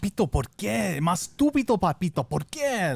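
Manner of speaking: high, loud scolding Spanish yell; mock furious father shouting; dad yelling in Spanish. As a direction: yell at your son like an angry Mexican dad. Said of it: "when he yells at me in Spanish".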